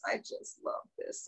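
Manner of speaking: hushed delighted whisper; whispered aside. Real, not acted. Aside during class.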